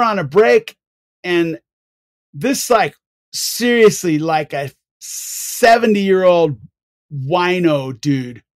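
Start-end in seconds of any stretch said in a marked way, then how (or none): none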